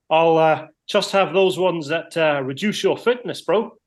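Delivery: lightly imitated New Zealand bloke; broad kiwi accent. Punchline in Kiwi coworker voice.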